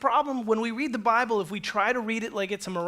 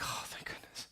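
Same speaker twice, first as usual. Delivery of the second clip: very soft whispered aside; hushed, acted relief; 14 dB quieter than usual. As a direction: whisper it like a secret sigh of relief. Crowd's private relief, voiced aside.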